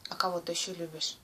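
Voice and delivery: ordinary talk, nothing marked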